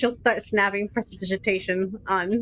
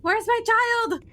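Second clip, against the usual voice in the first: higher, louder anxious call; worried mother; 10 semitones higher, 4 dB louder than usual. Acted. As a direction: call out searching for a lost little one. Character seeks her pet.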